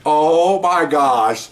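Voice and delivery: long drawn-out mock amazement; amazed. Detectives hearing the parrot cuss, slow amazed exclamation. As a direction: stretch every word out in disbelief.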